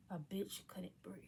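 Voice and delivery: unvoiced whisper; whispered aside. Real, not acted. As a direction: hush it like a secret.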